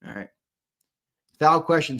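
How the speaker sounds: ordinary delivery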